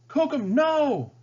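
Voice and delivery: high, slow desperate cry. A narrator's reading. Pocahontas crying out as Cookham charges, panicked. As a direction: shout to stop him charging. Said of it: "Pocahontas cried".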